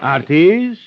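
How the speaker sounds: sharp rising call of a name. Acted. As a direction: snap the name to stop him. Boss snaps at henchman.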